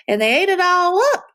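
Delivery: high, squeaky little bear voice; high squeaky Baby Bear. A narrator's reading. Baby Bear upset about the soup, high squeak. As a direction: squeak it out like a tiny cub. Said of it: "with a high squeaky voice".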